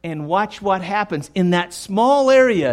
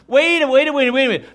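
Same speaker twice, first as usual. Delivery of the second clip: mock panicked stammer; 6 semitones higher, much faster than usual.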